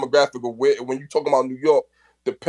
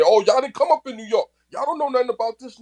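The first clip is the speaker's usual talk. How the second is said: higher, dismissive mock voice; mocking quote; 8 semitones higher than usual. Mocking the New York gatekeepers who shut out others. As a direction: sneer it like a gatekeeper brushing people off. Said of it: "to say".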